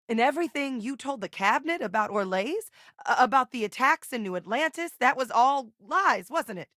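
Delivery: high-pitched, dismayed challenge; upset accusation. Acted. Accusing someone of lying to the cabinet, upset.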